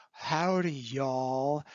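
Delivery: a retold, light American country twang; American country accent. Retelling a French student's impression of Americans, mild American country accent. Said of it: "very good representation of an American sort of country style".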